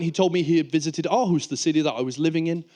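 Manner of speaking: ordinary delivery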